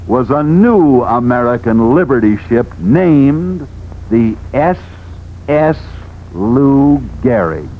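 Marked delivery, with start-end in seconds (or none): none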